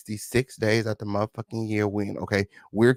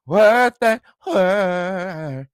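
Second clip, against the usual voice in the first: very slow, higher, stretched out; drawled catchphrase; 5 semitones higher, 5 dB louder, much slower than usual. Acted. Host stretching a catchphrase for viewers. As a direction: drag the catchphrase out.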